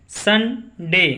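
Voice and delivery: Sunday very slowly, सन, pause, डे; teacher's drawn-out two-part Sunday; split into syllables. Shown as a demonstration. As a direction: sound out Sunday in two separate beats. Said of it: "दो बार उच्चारण हुआ".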